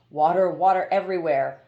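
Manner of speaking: ordinary delivery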